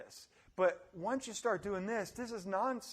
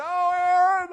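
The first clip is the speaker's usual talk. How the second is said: high, loud mock wail; student bursting into the office, whining; whiny student; 10 semitones higher, 13 dB louder than usual. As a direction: play a dramatic student. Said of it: "they come into my office and say".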